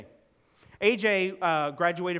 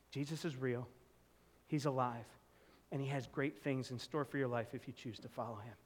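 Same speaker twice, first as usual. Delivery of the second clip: softer, sincere and earnest; sincere reassurance; 4 semitones lower, 9 dB quieter than usual. Retold or imitated. Pastor reassuring friends at the baptism, soft and sincere. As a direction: gently reassure a doubter.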